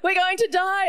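high, wailing mock panic from the schoolyard kids; panicked kids. Schoolkids panicking to each other about the water, high tearful wail. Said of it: "all the kids are like".

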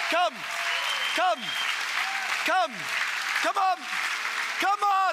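rally the congregation up to the altar; preaching at full force. Real, not acted. Pastor urging people forward, shouting come on.

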